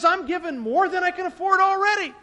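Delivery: loud, high, pleading objection; mock protest. Friend Rusty pushes back at the preacher, loud and high. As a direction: throw up your hands and cry out.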